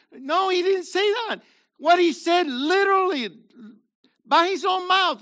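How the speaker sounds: rising pulpit fervour; fervent preaching. Acted. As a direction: preach it out to the congregation. Preaching at full force.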